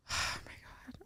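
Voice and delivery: whispered, sighing oh my God; exasperated sigh. Real, not acted. Wife sighing over her husband's car logic, whispered. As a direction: breathe it out in disbelief.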